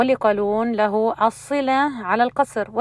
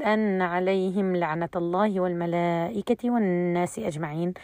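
softer, unbroken recitation from أن through أجمعين; recite again from the start; 3 semitones lower, 6 dB quieter than usual. Shown as a demonstration. Teacher shows the reader going back to join the verse, softer recitation. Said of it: "ترجع مرة ثانية وتقول".

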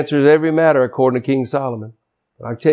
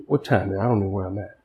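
softer, lower muttered aside; quiet aside; 7 semitones lower, 8 dB quieter, faster than usual. Real, not acted. Preacher checking the clock mid-sermon, quiet aside. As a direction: drop out of the sermon to check time.

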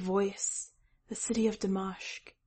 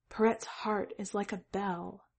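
usual speaking voice

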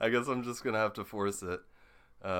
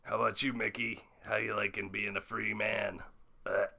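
Rick Sanchez impression. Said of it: "Rick Sanchez".

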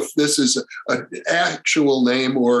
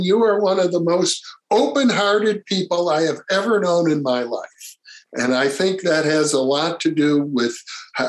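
ordinary delivery

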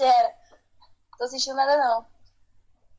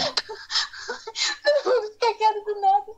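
laughing; 8 semitones higher, much slower than usual. Real, not acted. Laughs at sudden silence.

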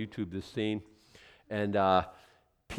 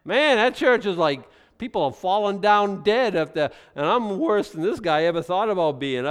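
loud, high, alarmed outsider; mock shocked churchgoer; 7 semitones higher, 9 dB louder than usual. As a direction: blurt it out like a scandalised visitor. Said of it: "They'll think".

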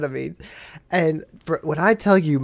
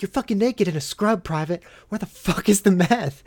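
higher-pitched, run-on mock outburst; retold exasperated demand; 5 semitones higher than usual. Officer to naked private in scrubs, exasperated retold demand. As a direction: act out losing patience in one rush.

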